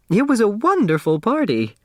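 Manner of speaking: high, eager little voice; a nervous seamstress keen to please; timid dressmaker. Acted. Dressmaker answering the judge, high and eager.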